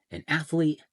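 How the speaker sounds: ordinary delivery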